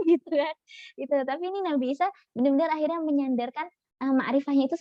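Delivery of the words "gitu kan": mock quick denial.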